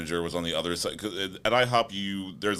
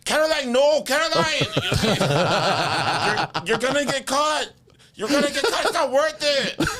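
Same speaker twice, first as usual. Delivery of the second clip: high, loud mock scream at a coworker; imitated screaming; 6 dB louder than usual. Imitating coworker screaming at the girl at the register, shrieked. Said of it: "My coworker is screaming at".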